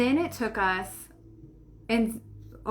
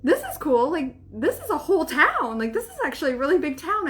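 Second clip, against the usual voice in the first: excited; 7 semitones higher, 5 dB louder than usual. Retold or imitated. Excited discovering a town.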